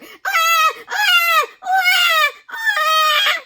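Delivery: put-on very high loud baby scream; baby yelling. A narrator's reading. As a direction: shriek in terror at the needle. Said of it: "yelled as loudly as it could".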